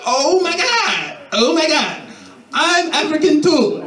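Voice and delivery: high, slow squeal; excited girl. Retold or imitated. The girl squealing at him, high and excited. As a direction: squeal with delight, drawn out.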